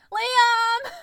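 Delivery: high, loud drawn-out call; crying out. A narrator's reading. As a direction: holler the name for rescue. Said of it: "I cried".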